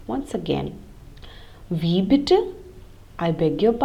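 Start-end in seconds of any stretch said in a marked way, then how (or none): 1.7-2.4 rising tune on "bitte"; question intonation. Shown as a demonstration. Teacher repeats the pronunciation for learners, "bitte" rising as a question. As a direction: ask "Wie bitte?" with a clear final rise. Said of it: "say as if you're asking a question".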